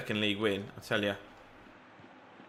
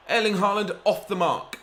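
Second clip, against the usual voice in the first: high, thrilled call of the scorer; excited; 7 semitones higher, 3 dB louder than usual. Real, not acted. Gamer hailing his striker's first goal, thrilled. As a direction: shout the name like a commentator.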